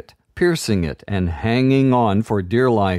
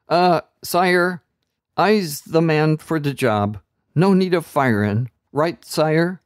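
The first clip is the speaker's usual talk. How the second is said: higher, nervous streetwise offer; street kid voice; 7 semitones higher than usual. A narrator's reading. Sewer rat nervously offering to pop the bubble. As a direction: pitch the idea carefully.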